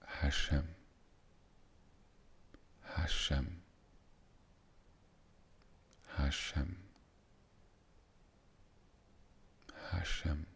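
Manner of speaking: soft meditative repetition; soft repeated name. Shown as a demonstration. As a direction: murmur the name gently as you drift off. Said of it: "So it just could be".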